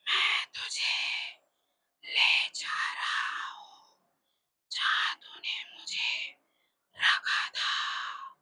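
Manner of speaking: slow, breathy ghost whisper; a dead spirit whispering slowly; ghostly whisper. A narrator's reading. Ghost whispers to Wangji that his time has come, slow and hollow. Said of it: "बोला".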